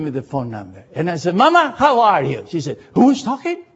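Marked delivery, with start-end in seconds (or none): 1.4-2.4 bright, loud, high hello; son-in-law's booming hello to mama; cheery greeting. Retold or imitated. Calling mother-in-law warmly. 2.9-3.6 surprised. Retold or imitated. Surprised mother-in-law on phone.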